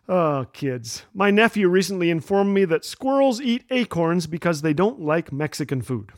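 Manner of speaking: ordinary talk, nothing marked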